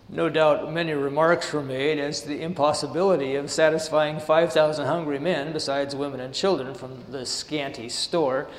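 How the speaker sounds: measured sermon reading of the text; steady devotional reading voice; reading aloud. Acted. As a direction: read the passage out to the congregation. Reads the passage aloud.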